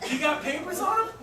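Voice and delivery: high, loud challenge to the seller; incredulous question. Retold or imitated. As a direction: question it with the voice jumping up. Retold reaction to shocking price.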